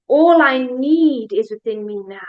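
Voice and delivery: "need" swelled loud and drawn out; slow affirmation leaning hard on "need"; stress on NEED. Shown as a demonstration. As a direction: lift and stretch the word "need". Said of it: "We're gonna change the emphasis".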